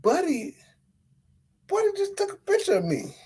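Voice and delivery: high, hushed, fast disbelief; stunned aside to friends; astonished whisper-aside. Retold or imitated. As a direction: nudge friends in shock. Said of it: "I'm sitting there like".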